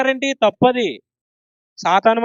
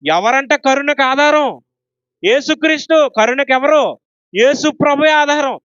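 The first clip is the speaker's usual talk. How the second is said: higher, louder, booming preaching; roaring like a lion; 6 semitones higher, 4 dB louder than usual. Shown as a demonstration. Preacher showing how to roar the answer, loud. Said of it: "సింహాల్లా గర్జించాలి".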